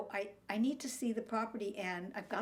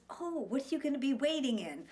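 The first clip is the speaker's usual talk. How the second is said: bright, cheery shop-manager voice, higher and louder; friendly store manager greeting a customer cheerfully; all smiles; 6 semitones higher, 4 dB louder than usual. Retold or imitated. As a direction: sound breezy and helpful, clueless about the fire. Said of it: "All smiles".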